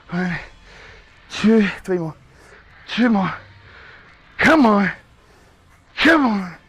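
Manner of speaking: loud high strained push; straining shout. Real, not acted. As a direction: yell through the last reps. Final set pushing hard.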